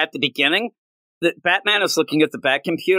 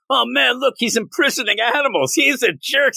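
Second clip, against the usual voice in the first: whiny critic voice; 8 semitones higher than usual. Retold or imitated. Mocking critics of Superman.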